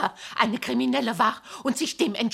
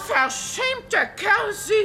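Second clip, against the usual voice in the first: indignant insult; 9 semitones higher, 5 dB louder than usual. Acted. Woman insults mocking salesman.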